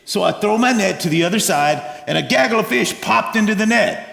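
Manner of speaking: normal talking voice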